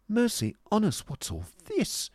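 high-pitched show of shock; put-on, wide-eyed surprise; affected astonishment. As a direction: feign amazement in a high voice. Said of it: "cried Miss Price in affected astonishment".

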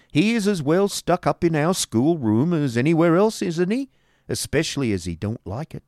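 high-pitched, emphatic schoolmaster voice; argumentative schoolmaster. A narrator's reading. Schoolmaster answering wife about the new teacher, argumentative. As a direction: reason it out with the wife.

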